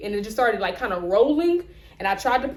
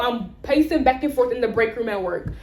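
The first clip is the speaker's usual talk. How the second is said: ordinary talk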